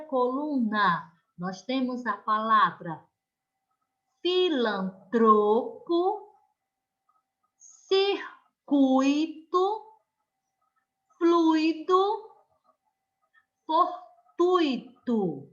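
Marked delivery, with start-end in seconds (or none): 4.2-15.5 filantropo, circuito, fluido, fortuito read slowly, second-to-last syllable leaned on; slow stressed reading. Shown as a demonstration. Teacher reads the first column of paroxytones to the class, slow and stressed. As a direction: spell out each word slowly, stressing the penultimate syllable. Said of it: "Vamos lá, junto com a tia [name]".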